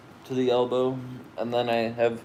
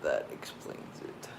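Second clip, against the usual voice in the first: whispered; 9 semitones lower, 10 dB quieter than usual. Real, not acted. Quiet echo of the aside.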